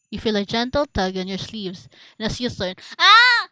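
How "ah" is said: very high, loud shriek; startled scream. Real, not acted. Reader turning round to a horror, startled scream. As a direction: shriek at a jump scare.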